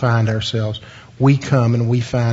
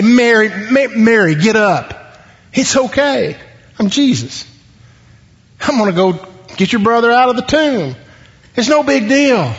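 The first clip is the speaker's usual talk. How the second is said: high-pitched mock brush-off of grief; imagined breezy reassurance; 8 semitones higher than usual. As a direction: play a breezy miracle-worker, voice pitched way up.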